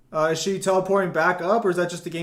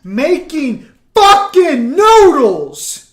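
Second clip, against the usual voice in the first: loud, slow mocking yell; 8 semitones higher, 12 dB louder, much slower than usual. Real, not acted. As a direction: yell it out, dragging the words. Mocking friend cooking noodles.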